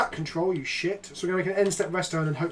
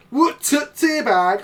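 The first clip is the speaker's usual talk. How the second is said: put-on slow, loud, higher British regional accent; mock regional British dialect; 7 semitones higher, 6 dB louder, much slower than usual. Streamer correcting Rob's attempt, hammed-up regional British accent. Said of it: "A regional dialect of Peasant Kenobi".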